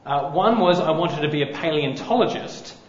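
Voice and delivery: usual speaking voice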